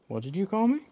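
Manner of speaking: ordinary talk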